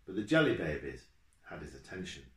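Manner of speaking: usual speaking voice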